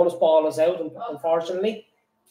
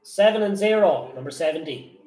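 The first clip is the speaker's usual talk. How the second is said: bingo caller; 3 semitones lower than usual. Acted. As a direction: call the numbers like a bingo hall. Bingo number calling.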